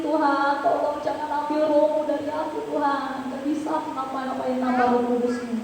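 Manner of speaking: ordinary talk, nothing marked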